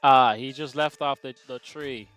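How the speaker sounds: ordinary talk, nothing marked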